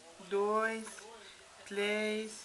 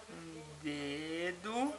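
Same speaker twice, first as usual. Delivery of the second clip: slow sing-song counting; 11 semitones lower, 9 dB quieter, much slower than usual. Real, not acted. Counting baby's fingers slowly.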